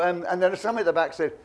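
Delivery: ordinary talk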